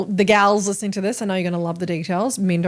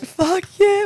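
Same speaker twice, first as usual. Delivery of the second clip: excited cheer; 12 semitones higher, 3 dB louder than usual. Retold or imitated. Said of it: "We were so excited".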